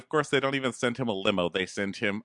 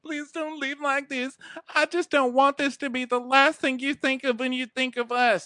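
very high, tearful pleading; hammed-up weepy begging; mock sobbing. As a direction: imitate her sobbing plea, pitched way up. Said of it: "sobbing. So she's like".

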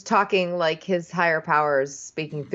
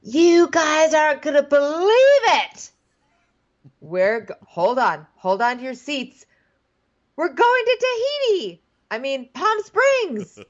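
excited Lance impression; 9 semitones higher, 4 dB louder than usual. Host teasing the cast, mimicking Lance's giddy announcement. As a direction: announce the trip like it's huge news. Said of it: "Lance first comes in and he's like".

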